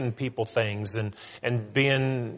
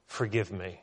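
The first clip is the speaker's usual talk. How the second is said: "Forgive me" lower and much softer, a pleading murmur; softly voicing David's confession; quiet humble plea; 4 semitones lower, 7 dB quieter than usual. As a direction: beg God gently for mercy. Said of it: "looks at God and says".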